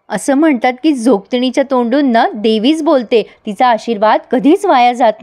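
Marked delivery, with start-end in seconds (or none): none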